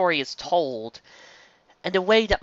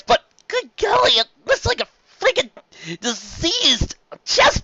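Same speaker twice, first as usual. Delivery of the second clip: loud, much higher horrified shouting; disgusted outburst; 11 semitones higher, 7 dB louder than usual. Real, not acted. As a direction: rant in shocked disgust. Reacting to appendix pictures.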